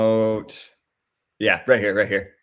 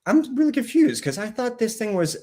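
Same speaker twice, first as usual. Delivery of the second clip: baffled inner thought; 10 semitones higher than usual. Retold or imitated. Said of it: "I'm thinking to myself".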